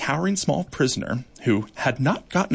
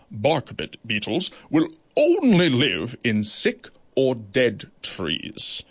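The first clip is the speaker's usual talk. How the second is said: grave judge verdict; slower than usual. Acted. Goliath ruling on the beetles, slow and grave. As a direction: hand down the sentence.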